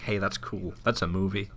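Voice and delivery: a soft, lightly put-on American accent. Podcaster mocks movie trailers in a mild American accent. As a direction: quietly try out an American accent. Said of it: "that was my American accent".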